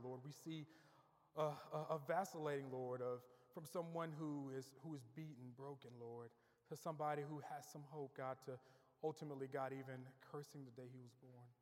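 low, soft congregational prayer. Real, not acted.

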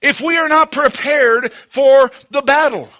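emphatic, driving sermon voice; full-force preaching. Acted. Preacher warning church about Satan's attacks, forceful. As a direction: hammer the warning home from the pulpit.

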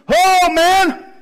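high, loud ominous warning; mock alarm. Paul warning the sailors, high and loud mock alarm. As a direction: sound the alarm, hammed up a little. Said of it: "Paul stands up and says".

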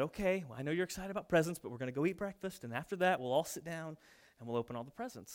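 put-on soft, patient explanation to kids; calm parent. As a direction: settle excited boys, quietly. Parent calmly explains breakfast first.

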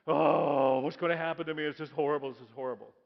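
loud, hammed-up woe; mock lament. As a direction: wail it like a martyr. Joseph voiced lamenting.

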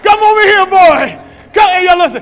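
put-on high, loud enthusiastic coaching of the boy; hyped-up praise. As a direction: pump him up after the fall. Dad hyping up fallen son.

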